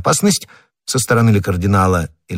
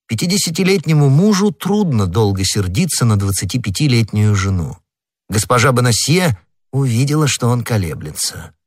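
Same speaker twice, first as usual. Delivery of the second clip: usual speaking voice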